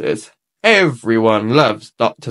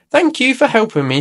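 Peppa's voice; 9 semitones higher than usual. A narrator's reading. Peppa thanks Nurse Fox.

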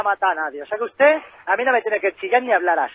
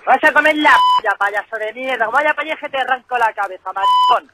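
furious shouting insults; angry; 3 semitones higher, 6 dB louder than usual. Real, not acted. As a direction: yell every insult in real rage. Furious caller trading insults.

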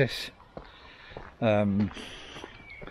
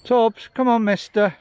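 high, louder call to a dog; 10 semitones higher, 5 dB louder than usual. Real, not acted. Calling the dog.

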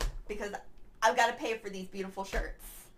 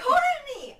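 high, loud shout of her name; calling out; 13 semitones higher, 5 dB louder than usual. Acted. Arriving late and shouting her friend's name. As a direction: call her name across the room.

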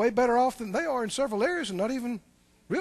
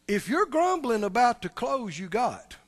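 ordinary delivery

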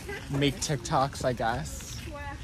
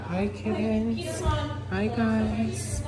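high, slow cooing; 10 semitones higher, much slower than usual. Real, not acted. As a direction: coo at the kittens. Greeting kittens.

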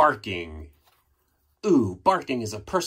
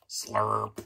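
plain reading voice

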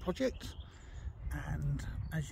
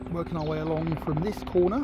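raised voice over rotor noise; yelling over helicopter; 7 dB louder, faster than usual. Real, not acted. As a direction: shout to be heard over noise. Apologies for the helicopter.

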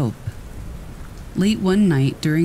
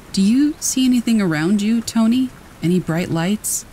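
urgent doctor; 7 semitones higher than usual. Acted. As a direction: call out to the dying patient. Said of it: "asked the doctor".

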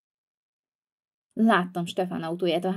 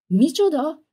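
shocked outcry; 8 semitones higher, 5 dB louder, slower than usual. Acted. Grounded for life.

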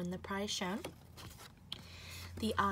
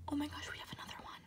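fast breathless whisper; excited whisper; 5 semitones higher, 8 dB quieter, much faster than usual. Real, not acted. Finding yet another seven, fast excited whisper.

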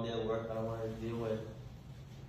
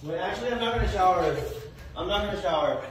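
loud sudden reversal; 3 semitones higher, 7 dB louder than usual. Acted. Suspicious partner bursts back in.

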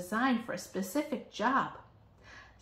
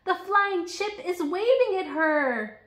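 higher, louder, slow wonder; delighted reveal; 10 semitones higher, 7 dB louder, slower than usual. A narrator's reading. Narrator revealing chip waving at Jenny, slow delighted wonder.

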